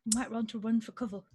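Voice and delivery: tentative attempt at an Australian accent; Steve Irwin. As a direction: lightly imitate an Aussie wildlife host. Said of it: "Steve Irwin voice".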